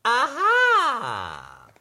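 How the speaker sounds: wailing high aha; high crying aha. Acted. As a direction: cry out the aha page sound. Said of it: "Our page-turning sound today will be".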